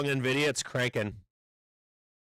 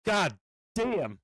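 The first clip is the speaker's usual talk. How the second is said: higher-pitched, exasperated curse; real, rueful anger; furious; 6 semitones higher than usual. Real, not acted. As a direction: swear in frustrated disbelief. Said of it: "I'm fucking furious now".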